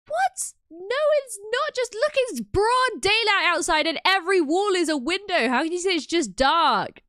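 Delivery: very high, loud, incredulous What?; shocked outrage. Real, not acted. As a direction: squeal in disbelief then argue hard. Shocked at chat claim.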